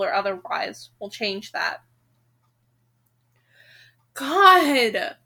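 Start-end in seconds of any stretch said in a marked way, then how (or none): 4.1-5.2 loud exasperated cry; real frustration at the book; frustrated outburst. Real, not acted. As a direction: blurt it out, fed up. Reader furious at the book.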